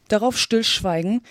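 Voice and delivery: usual speaking voice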